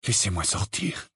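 cold and quiet; icy, restrained demand. Acted. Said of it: "d'un ton froid".

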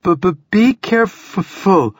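shivering, stuttering with cold; chattering teeth, high and slow. Acted. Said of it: "teeth chattering now despite her efforts".

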